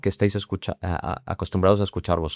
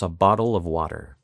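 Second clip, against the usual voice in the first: American accent with flapped T in "bottle" and "water"; General American accent; 2 semitones lower than usual. Shown as a demonstration. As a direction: General American, easy flap Ts. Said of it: "en americano decimos".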